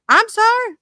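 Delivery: squeaky high-pitched sorry, half joking; high mock apology. Real, not acted. Mock apology after rant.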